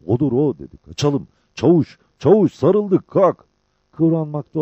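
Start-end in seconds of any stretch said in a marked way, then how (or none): 0.1-0.6 raised, urgent voice; excited. A narrator's reading. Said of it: "Cabbar heyecanla". 0.8-3.5 loud, high urgent cries; excited alarm. A narrator's reading. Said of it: "Cabbar heyecanla".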